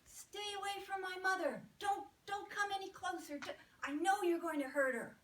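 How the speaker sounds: voicing an enraged baby, yelling high and loud; furious screaming. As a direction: scream at the doctor to stay away. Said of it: "I am screaming at the doctor".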